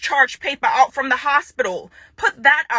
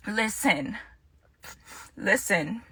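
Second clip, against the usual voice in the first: low, much softer, reining it in; restrained; 3 semitones lower, 10 dB quieter, slower than usual. Real, not acted. Woman pausing her rant at her ex, restrained. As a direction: drop to a low, quiet warning.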